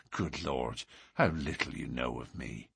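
low, hushed bitterness; bitter. A narrator's reading. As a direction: drop low and soft, bitterly self-mocking. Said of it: "McMurdo laughed bitterly".

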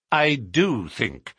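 slow, firm stress on do; I DO think, deliberate and slow; emphatic. Acted. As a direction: lean hard on do, slowly. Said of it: "with emphasis".

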